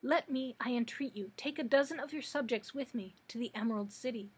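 ordinary delivery, nothing marked

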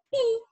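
pi drawn out high; teacher modelling pi; syllable pi. Shown as a demonstration. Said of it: "que começam com a sílaba".

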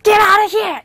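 shouted get out; shouting. Shown as a demonstration. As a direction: throw him out with a shout. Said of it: "이렇게 소리를 치면서".

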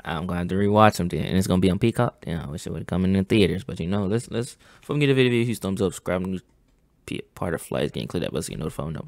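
ordinary talk

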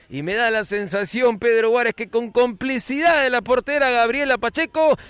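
ordinary talk

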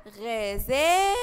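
غزالة drawn out slowly; model word. Shown as a demonstration. Teacher models the fatha word slowly. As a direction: stretch غزالة for the class. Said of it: "كلمة".

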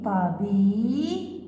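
طبي held very long; very slow طبي; slow syllable. Shown as a demonstration. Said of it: "مع حرف المد إي،".

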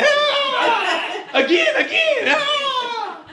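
put-on squealing happy kid. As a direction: squeal like a kid being swung around. Child playing, shrieking with delight.

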